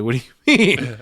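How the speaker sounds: loud, laughing question; laughing disbelief. Retold or imitated. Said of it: "I'm like".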